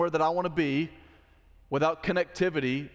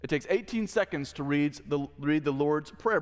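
ordinary delivery

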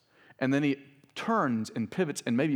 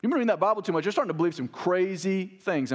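higher, louder scoff; mocking paraphrase; 6 semitones higher, 3 dB louder than usual. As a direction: scoff at him. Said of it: "That's what he says".

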